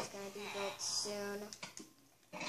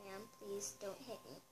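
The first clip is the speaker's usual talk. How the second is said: timid plea; 4 semitones lower, 12 dB quieter than usual. Acted. Scared clerk pleads.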